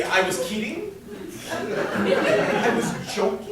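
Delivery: laughing, rising "kidding?" and breathy drawn-out "joking?"; sheepish take-back. Retold or imitated. Groom trying to take back his proposal, sheepish laughing rise. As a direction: try to laugh it off, hopeful it works.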